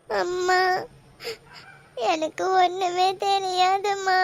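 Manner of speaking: tearful plea, voice breaking into sobs; crying. Acted. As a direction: daughter crying to her mother that she knows nothing, sobbing. Daughter pleads with mother, crying.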